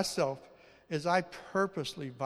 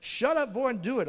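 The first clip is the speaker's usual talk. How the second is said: louder, higher imitated scolding; mock-stern parental command, raised voice; scolding mother; 8 semitones higher, 6 dB louder than usual. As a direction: order the boy about like his mother. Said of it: "I also grew up with".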